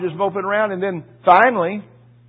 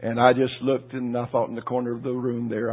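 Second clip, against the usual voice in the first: ordinary talk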